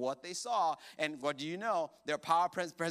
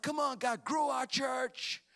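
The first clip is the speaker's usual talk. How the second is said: higher, slow pleading shout; mock plea; 6 semitones higher, slower than usual. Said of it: "I'm like".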